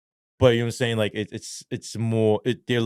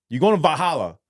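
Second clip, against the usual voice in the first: guard voice; 7 semitones higher, 6 dB louder than usual. Retold or imitated. Guard tells destination.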